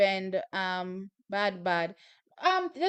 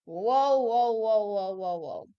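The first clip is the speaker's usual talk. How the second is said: amazed whoas; 2 semitones lower, slower than usual. Real, not acted. Watching another huge jump.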